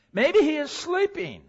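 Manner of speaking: high, teasing mock; mocking taunt. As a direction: sneer it like a taunt. Mocking Baal, laughs after.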